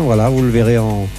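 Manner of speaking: normal talking voice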